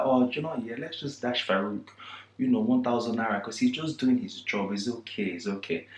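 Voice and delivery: softly voiced kind grandma. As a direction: soothe it over quietly. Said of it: "she's such a nice lady".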